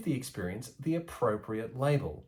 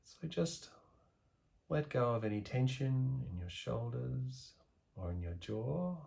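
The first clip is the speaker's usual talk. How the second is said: guided meditation; 5 dB quieter than usual. Acted. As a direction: ease the listener into calm, letting the voice sink softer. Guided meditation practice begins.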